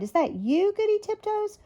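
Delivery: high, rising call back; surprised question. A narrator's reading. Timmy calling up from the hole to his wife, surprised question. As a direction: answer with pitch climbing steeply to the end.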